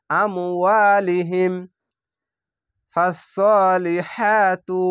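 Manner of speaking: slow, drawn-out chanted recitation; Quranic recitation. Shown as a demonstration. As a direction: recite each word slowly with tajweed.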